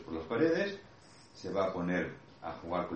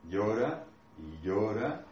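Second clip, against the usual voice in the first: ordinary talk, nothing marked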